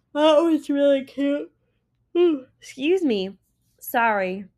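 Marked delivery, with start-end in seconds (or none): 0.1-3.4 higher, louder words bent by a yawn; tired yawn breaking in. Real, not acted. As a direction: talk through a rising yawn. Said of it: "I apologize for my yawning".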